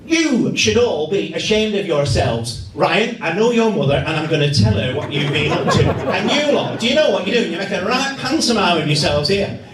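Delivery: put-on a little old lady scolding the youths, laughing through it. Little old lady scolding menacing youths, high and stern. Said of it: "she says".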